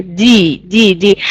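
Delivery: D with no puff of air, voiced; unaspirated D. Shown as a demonstration. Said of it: "you can hear more like here".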